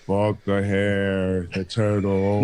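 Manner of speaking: very slow, low Southern-style drawl; mock slow talker. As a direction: drag it out low like a lazy storyteller. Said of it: "It's, like, real slow".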